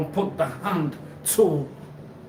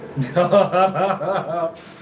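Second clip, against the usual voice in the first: loud, rapid run of laughter; 5 dB louder than usual. Real, not acted. After repeating "you" to the listener, laughing loudly. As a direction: burst out in a rolling laugh. Bursts out laughing mid-talk.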